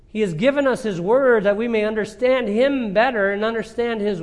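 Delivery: high, loud preaching at full force; fervent preaching. Acted. Preacher to his congregation, listing God's gifts, full-force preaching. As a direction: lift the congregation with each gift.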